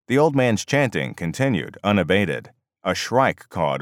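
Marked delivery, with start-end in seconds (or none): none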